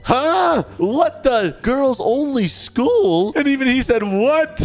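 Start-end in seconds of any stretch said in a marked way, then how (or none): 0.0-3.3 high-pitched, incredulous outburst; shocked dad. Retold or imitated. Dad reacting to a girls only school, shocked. 4.0-4.5 high-pitched mock disbelief; shocked son. Son learns of girls-only school.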